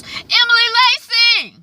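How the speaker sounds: high, loud yelled name; shrill-free loud holler of a name. Retold or imitated. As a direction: call her down from upstairs. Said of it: "she yells".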